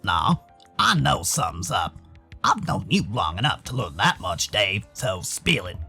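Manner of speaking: very low voice, knowing and pressing; deep-pitched buddy who sees through it; deep-voiced insistent friend. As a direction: press him in a low, easy voice.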